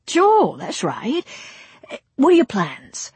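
eager film director with a laugh; pushy producer charm; brash director. Acted. Brady speaks.

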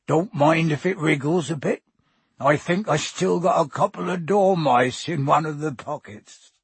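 offhand warning in a big rustic voice; Hagrid, easygoing. Acted.

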